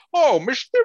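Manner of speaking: high, loud fake British accent in French. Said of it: "they try to have a British accent".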